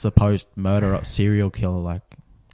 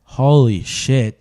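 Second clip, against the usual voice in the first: slow, higher, louder disbelief; stunned; 4 semitones higher, 6 dB louder, much slower than usual. Real, not acted. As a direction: drag it out in real shock. Stunned by murder confession.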